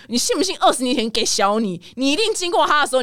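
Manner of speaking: ordinary talk, nothing marked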